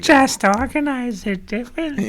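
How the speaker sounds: high, slow witchy drawl; witch voice. Retold or imitated. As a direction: ham it up like a witch. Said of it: "It sounded like a witch".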